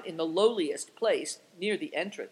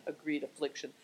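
ordinary delivery

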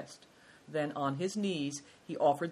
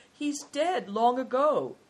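high, louder, drawn-out protest; unhappy protest; 9 semitones higher, 6 dB louder, slower than usual. A narrator's reading. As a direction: draw it out slowly, high and upset.

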